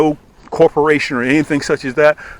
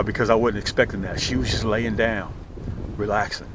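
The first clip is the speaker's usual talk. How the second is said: usual speaking voice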